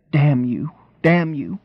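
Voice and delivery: mild despair mixed with tenderness; despairing, solicitous. A narrator's reading. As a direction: curse him with worry and care. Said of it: "in a tone of despair and solicitude at once".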